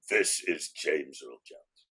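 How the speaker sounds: very low, slow, resonant bass impression; slow booming movie-star bass on the phone; deep James Earl Jones voice. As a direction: drop way down and intone it slowly like the famous actor. Retold phone call from the actor.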